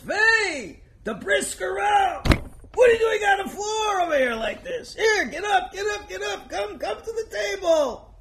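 high, loud cry of shock; a rebbe shouting across the room in shock; astonished shout. Acted. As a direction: call out in alarmed disbelief. Rebbe shocked to find rabbi on floor.

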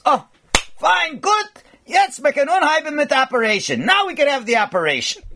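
the Rebbe, pleased, tells the doctor they can operate; pleased old Rebbe. Retold or imitated. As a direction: pipe up brightly, satisfied at last. Rebbe voiced, pleased and brisk.

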